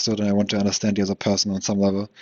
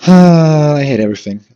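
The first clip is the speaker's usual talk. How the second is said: louder, higher and slower, fed up with it all; exasperated outburst; 6 semitones higher, 10 dB louder, slower than usual. Real, not acted. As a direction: let it burst out slowly in exasperation. Frustrated rant about people.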